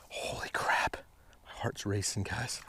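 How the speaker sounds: a breathless whisper of disbelief; stunned whisper. Real, not acted. Angler whispering to viewers as the walleye nears. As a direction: gasp it out under your breath.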